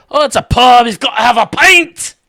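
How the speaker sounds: loud, high Scottish pub holler; high-pitched shouted Scottish brogue; Scottish accent. Acted. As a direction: bellow it like a rowdy Scotsman. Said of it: "when I do the, the Scottish accent".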